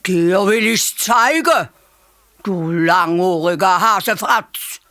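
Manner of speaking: slow, loud threatening growl; furious mother elephant. A narrator's reading. Furious mother elephant threatens the hare, slow and loud. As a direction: threaten through clenched anger. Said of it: "Da ist die Elefantenmutter wütend geworden".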